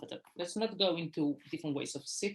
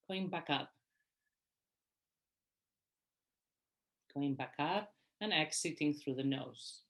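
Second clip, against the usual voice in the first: guided meditation; 3 dB quieter than usual. Real, not acted. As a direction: guide them gently through each breath, unhurried. Leads a breathing meditation.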